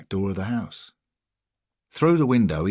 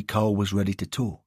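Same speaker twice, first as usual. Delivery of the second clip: plain reading voice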